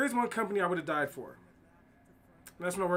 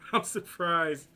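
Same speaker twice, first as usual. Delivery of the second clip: "I'm surprised" said slowly through a laugh, louder; 5 dB louder, slower than usual. Real, not acted. Says it again while laughing.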